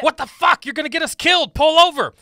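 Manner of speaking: loud high furious shouting; angry yelling. Acted. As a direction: bark at the reckless driver. Passenger yells at reckless driver.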